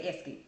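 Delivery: "esket" with a K sound slipped in; mispronounced exit. Shown as a demonstration. Vlogger showing viewers her habitual mispronunciation "esket". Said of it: "I don't know why I say".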